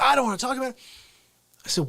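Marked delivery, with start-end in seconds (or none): 0.0-0.8 grandma refusing. Retold or imitated.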